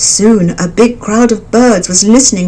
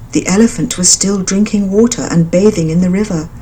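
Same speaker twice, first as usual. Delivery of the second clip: ordinary delivery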